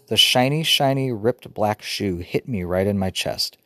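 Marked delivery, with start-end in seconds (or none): none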